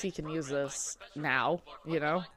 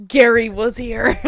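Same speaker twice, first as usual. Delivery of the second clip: loud, gloating schoolboy jeer; mock rival taunt; 3 semitones higher, 11 dB louder than usual. Reading the rival's sign aloud as a loud gloating jeer. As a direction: crow it like a bratty rival.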